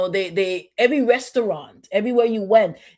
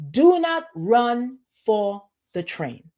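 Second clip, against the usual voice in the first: 1 slow emphatic; much slower than usual. Real, not acted.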